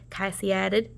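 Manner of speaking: ordinary delivery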